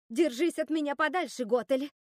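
loud, high, panicked warning; panicking. A narrator's reading. Руби wards off Готель, panicking. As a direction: fend her off with rising alarm. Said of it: "запаниковала Руби".

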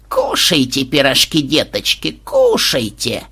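put-on old-lady voice, slow and ominous; a grandma with a creepy giggle behind it; sly, drawn-out sinister granny coaxing. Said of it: "как-то зловеще хихикнула бабуля Флэш".

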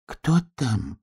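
high, small question; thin little voice. A narrator's reading. Just-woken donkey asking at the door, thin voice. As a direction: pipe up timidly from inside. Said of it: "тоненько спросил ослик".

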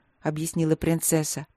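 usual speaking voice

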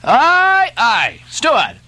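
loud high mocking cry. Stubb turning on the steward, loud mocking cry. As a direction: bellow it at the steward. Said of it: "cried Stubb".

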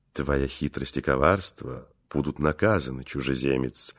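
low, hushed and menacing; sinister. A narrator's reading. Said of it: "зловеще произнёс".

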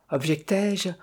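ordinary delivery, nothing marked

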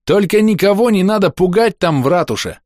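loud high-pitched wail of warning; yelling. A narrator's reading. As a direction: holler an urgent warning after someone. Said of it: "завопил я".

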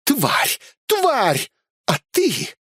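high-pitched female fury; furious. A narrator's reading. Elsa lashing out after slapping the bandit, furious. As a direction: spit out insults in a high voice. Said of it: "насладиться её прекрасной злостью".

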